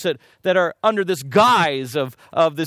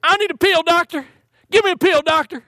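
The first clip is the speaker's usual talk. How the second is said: Texan patient; 10 semitones higher, 4 dB louder than usual. Retold or imitated. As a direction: plead in an exaggerated high Texan accent. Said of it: "from Texas starts talking like that".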